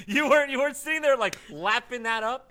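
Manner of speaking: high, loud teasing laugh; laughing tease. Real, not acted.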